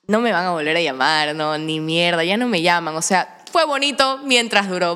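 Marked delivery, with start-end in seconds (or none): 0.1-3.0 resigned. Retold or imitated. Acts out resigned pessimism.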